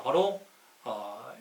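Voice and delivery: usual speaking voice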